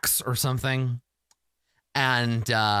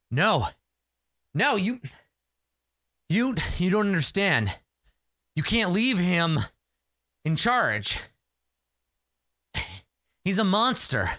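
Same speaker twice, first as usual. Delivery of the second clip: higher, insistent pleading about the monster; urgent warrior; 6 semitones higher than usual. Acted. Warrior urges the hero not to leave Shekar in charge. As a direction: warn them the leader is dangerous.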